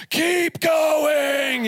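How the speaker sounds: high desperate shout from the floor; screaming to keep going. Retold or imitated. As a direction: yell at the band to keep playing. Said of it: "but I'm like".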